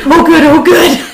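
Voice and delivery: high, giggling oh good; laughing relief. Retold or imitated. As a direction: squeal with relief at finally getting the toilet. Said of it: "That was Petey".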